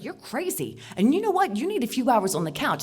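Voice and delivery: rising into a rebuke; loud, high scolding; scolding outburst. Retold or imitated. Telling off the harasser.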